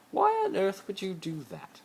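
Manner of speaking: a hint of a posh rich boy pleading; Malfoy voice. Malfoy pleading with Harry to play his boyfriend, lightly voiced.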